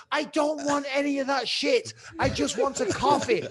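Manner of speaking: high, loud exasperated refusal; wound up. Retold or imitated. Customer retelling his plea for just a coffee, exasperated. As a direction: insist with retold frustration. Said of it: "starting to wind myself up".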